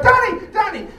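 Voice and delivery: high, loud calling out of a name; piercing call. Retold or imitated. Woman in the street calling out to the comic, high and loud. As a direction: yell the name across a busy street. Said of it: "She goes".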